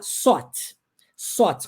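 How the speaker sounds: "sought" said with a plain S; the S mistake; "sought" with S, not th; wrong th as S. Shown as a demonstration. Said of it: "com som de S".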